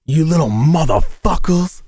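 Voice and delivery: hammed-up angry mom voice; mom scolding. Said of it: "And my mom goes".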